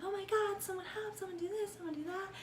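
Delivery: high-pitched, hushed mock panic; imitated panic. Retelling how the sister begged for help, mock panic. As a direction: play a panicking girl in a high, small voice. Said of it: "having her own panic attack".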